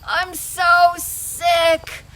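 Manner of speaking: slow, drawn-out moan; moaning sick woman. Acted. Maria moaning weakly from her bed. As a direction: groan it out from a sickbed. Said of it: "She's there laying in her bed".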